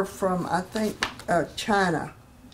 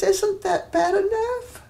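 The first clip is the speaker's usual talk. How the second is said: high, drawn-out plaintive question; retold distressed patient; 11 semitones higher, slower than usual. Crying patient answering the preacher, retold distress. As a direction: imitate her distress, pitched way up and slow. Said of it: "she starts crying".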